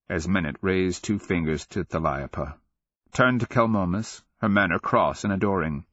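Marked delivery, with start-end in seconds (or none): none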